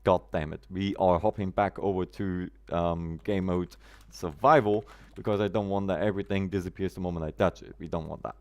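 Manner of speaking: ordinary talk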